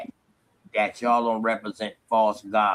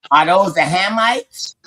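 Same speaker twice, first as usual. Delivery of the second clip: mocking; 6 semitones higher, 5 dB louder, slower than usual. Real, not acted. Mocking follow-up question.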